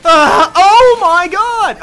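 high, loud, slow drawn-out scream; startled scream. Real, not acted. Streamer suddenly jumped by Toga, startled scream. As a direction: let it rip as you're ambushed.

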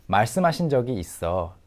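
ordinary talk, nothing marked

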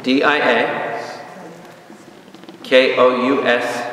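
ordinary delivery

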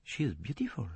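soft, quiet admiring murmur. A narrator's reading. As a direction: breathe it out admiringly. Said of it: "He murmured".

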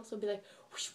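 ordinary talk

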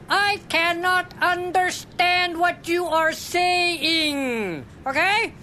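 slow and deliberate. Acted. To the drive-thru speaker, slow and deliberate. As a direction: spell it out word by word.

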